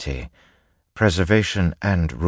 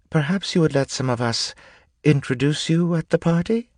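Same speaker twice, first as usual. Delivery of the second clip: high-pitched woman's voice, politely asking; timid noblewoman; 12 semitones higher than usual. Acted. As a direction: pitch it well up for a courteous, tentative request. One of the women speaks.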